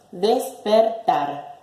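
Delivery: slow "Despertar" in three separate beats; split into syllables. Shown as a demonstration. Teacher presenting a new example, syllable by syllable. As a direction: break "Despertar" into des, per, tar.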